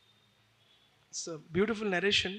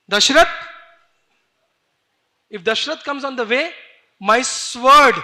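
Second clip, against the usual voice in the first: loud, high, furious threat; enraged, pitch raised, pounding out the threat; mad with rage; 5 semitones higher, 14 dB louder than usual. Acted. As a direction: shout it like a raging warrior. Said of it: "he went mad".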